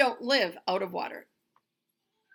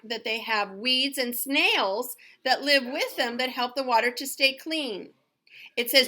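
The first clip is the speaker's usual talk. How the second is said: put-on clear, careful read-aloud for young kids; reading to children; 3 semitones higher, 8 dB louder than usual. Teacher reading a goldfish book to her class, in a clear storytime voice.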